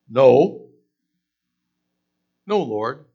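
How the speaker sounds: a sharp loud no; loud refusal. Retold or imitated. Peter refusing God's command, loud. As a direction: bark the no at the Lord.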